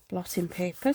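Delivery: ordinary delivery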